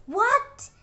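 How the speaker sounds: loud, high outraged cry; angry stepmother. Acted. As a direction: shout it like a stepmother who can't believe it. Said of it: "the stepmother was angry and said".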